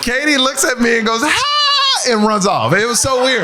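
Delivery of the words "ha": long, high "ha!"; mock shocked gasp. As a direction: imitate a high, drawn-out shocked cry. Imitates Katy's reaction before running.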